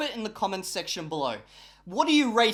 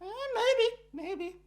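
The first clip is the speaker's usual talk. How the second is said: coy gossiping wall; slower than usual. Pretend. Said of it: "The walls are like".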